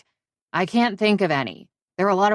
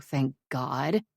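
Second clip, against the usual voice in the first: usual speaking voice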